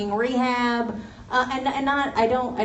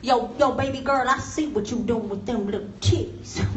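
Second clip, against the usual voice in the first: catcaller; 3 semitones higher, 5 dB louder than usual. Retold or imitated. Guy catcalling, acted street voice.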